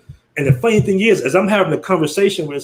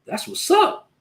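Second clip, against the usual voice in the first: impressed; 11 semitones higher, 4 dB quieter, slower than usual. Retold or imitated. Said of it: "I'm like".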